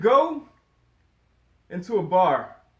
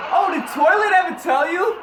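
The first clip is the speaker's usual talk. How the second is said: loud, high, laughing mom tease; teasing shout; 8 semitones higher, 7 dB louder than usual. Pretend. Mom teasing her son in front of his friend, laughing shout. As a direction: blurt it out to embarrass him.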